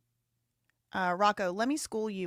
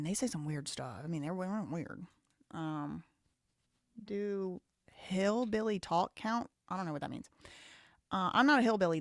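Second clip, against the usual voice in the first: usual speaking voice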